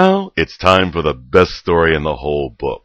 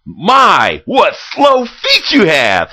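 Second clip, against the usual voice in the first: excited; 9 dB louder, slower than usual. Retold or imitated. Excited narrator voices Red.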